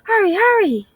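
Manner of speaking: high, loud hurried cry; urgent call. A narrator's reading. Grandmother urging the squirrel into the burrow, high and loud. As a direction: yell hurry from the doorway. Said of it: "she called".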